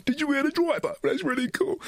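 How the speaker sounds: high-pitched imitation of an impressed passenger; put-on high voice, tickled and impressed; delighted passenger. Acts out amused passengers behind him.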